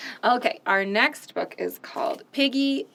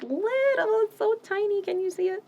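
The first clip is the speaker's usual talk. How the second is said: higher, small voice; tiny voice; 11 semitones higher than usual. Acted. Reader using a tiny high voice for the little painting. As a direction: make your voice tiny.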